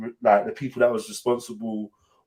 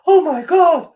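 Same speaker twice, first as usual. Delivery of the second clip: high, loud retold cry of shock; retold shocked gasp; 6 dB louder, slower than usual. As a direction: imitate her stunned cry at the news, pitched high. Woman hears of death, faints.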